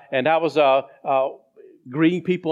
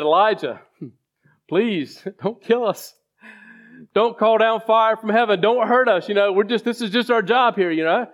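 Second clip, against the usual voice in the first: higher, begging voice of frightened soldiers; pleading soldiers; 5 semitones higher than usual. Retold or imitated. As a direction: plead with the prophet not to kill you. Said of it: "They were a little bit more respectful".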